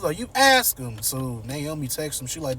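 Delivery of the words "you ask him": high, loud snapping retort; angry retort. Retold or imitated. Martin refusing to text his father, high loud anger. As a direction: throw it back at her, angry. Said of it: "he mad".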